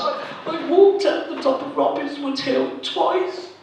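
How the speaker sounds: high, wobbly voice of a weeping dieter; tearful woman. Retold or imitated. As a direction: whimper it out like she's about to cry. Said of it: "this woman in front of me started crying".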